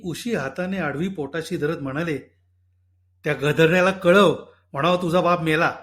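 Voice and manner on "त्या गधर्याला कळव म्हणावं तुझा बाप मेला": loud, bitter outburst; angry outburst. Acted. Husband to wife about their son, loud bitter anger.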